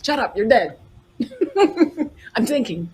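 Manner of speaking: ordinary talk, nothing marked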